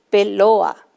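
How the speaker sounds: peloa said with the t dropped, vowels run together; word without t. Shown as a demonstration. Teacher showing children pelota without the t. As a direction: drop the t: peloa. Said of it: "si omitimos en pelota el sonido t".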